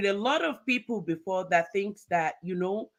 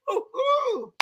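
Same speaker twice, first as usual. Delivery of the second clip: high whoop of delight; host whooping to pump up viewers; excited whoop; 14 semitones higher than usual. Real, not acted. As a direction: let out a high happy hoot. Host cheering herself on.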